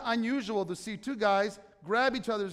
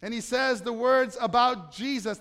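ordinary delivery

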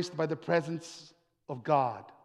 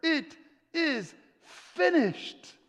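high, slow, word-by-word cry; dramatic cry; 10 semitones higher, much slower than usual. Acted. Preacher voicing Jesus' last words on the cross, slow cry. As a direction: cry it out slowly, pausing between words. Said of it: "then he cries these words".

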